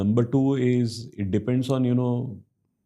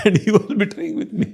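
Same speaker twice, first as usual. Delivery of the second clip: laughing; 10 semitones higher than usual. Real, not acted. Laughing at the betrayal story.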